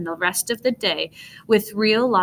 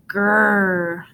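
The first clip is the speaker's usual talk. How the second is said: fuller, louder monster growl. A narrator's reading. Monster behind tree growling louder at the boys. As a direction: let the growl swell. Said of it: "The sound grew louder".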